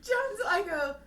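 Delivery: ordinary talk, nothing marked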